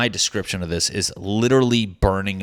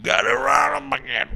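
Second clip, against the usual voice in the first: very high squeaky voice, pleased with herself; squeaky triumph. Pretend. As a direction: squeal it like a tiny victory. Celebrating getting the line right.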